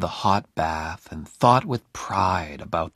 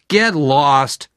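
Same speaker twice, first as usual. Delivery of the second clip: loud, higher brush-off; annoyed dismissal; 4 semitones higher, 6 dB louder than usual. A narrator's reading. Oliver dismisses laughing footballers, annoyed. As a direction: send them away with a raised voice.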